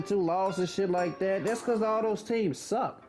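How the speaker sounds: usual speaking voice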